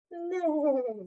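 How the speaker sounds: high neigh; whinny it out high like a horse. Acted. Horse's sound in story.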